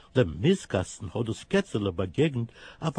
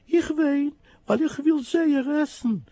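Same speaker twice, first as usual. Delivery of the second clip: very high, plaintive kitten voice; high whiny little cat; 4 dB louder than usual. Acted. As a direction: squeak it out like a crying kitten. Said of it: "macht das Kätzele".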